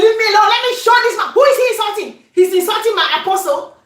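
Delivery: playacting an outraged wife; wife defending her husband, hammed-up anger; mock fury. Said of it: "I said".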